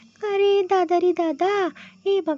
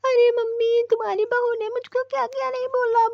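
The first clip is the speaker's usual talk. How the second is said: ordinary talk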